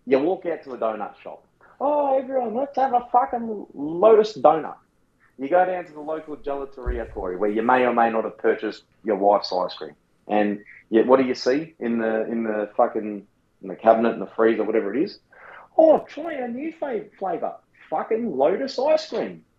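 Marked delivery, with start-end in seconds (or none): 1.8-4.8 mocking shop voice. Mocking donut shop staff. 15.8-19.4 high, slow mocking sales pitch. Mocking gelateria staff.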